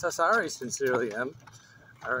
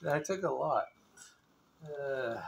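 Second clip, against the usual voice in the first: usual speaking voice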